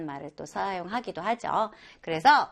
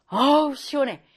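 louder, pleased diner after hot soup; hearty satisfaction; 4 semitones higher, 4 dB louder than usual. Acted. A diner praising hot soup to the cook, pleased and louder. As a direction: savor the soup and call for more. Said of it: "막 이렇게".

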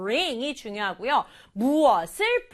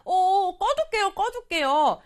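put-on higher, louder eager kid; a pupil's voice, higher and a bit louder; child's voice; 7 semitones higher, 4 dB louder than usual. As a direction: play the pupil, bright and keen. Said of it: "그랬더니 아이가".

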